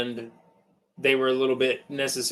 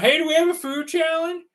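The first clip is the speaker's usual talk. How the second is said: high-pitched mock call across a restaurant; imitated yelling; 12 semitones higher than usual. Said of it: "they're yelling through the restaurant".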